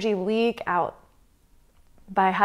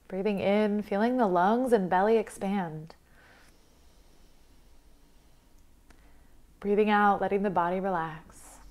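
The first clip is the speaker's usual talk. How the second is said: guided meditation; 6 dB quieter than usual. Acted. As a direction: guide them calmly through the breath, leaving space between phrases.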